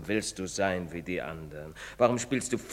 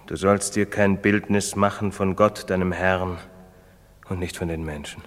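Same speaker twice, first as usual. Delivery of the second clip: solemn proclamation; 3 semitones lower, 7 dB louder than usual. Acted. Priest recites commandment, louder.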